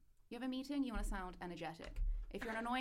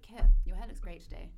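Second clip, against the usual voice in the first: sarcastic-sounding compliment; 15 dB louder than usual. Shown as a demonstration. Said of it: "always either sarcastic or monotonous".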